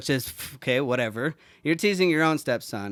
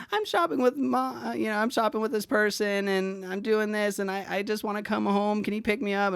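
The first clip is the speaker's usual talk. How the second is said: imitated tearful kid; 8 semitones higher than usual. Upset kid phoning for a ride home, imitated tearful pleading. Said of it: "that's why he's always crying".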